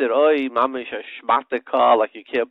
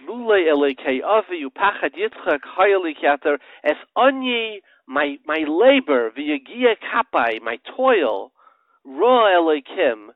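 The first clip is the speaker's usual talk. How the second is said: preaching at full force; 4 semitones higher, 5 dB louder than usual. Acted. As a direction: proclaim each phrase with weight. Preaching verses on labor, loud.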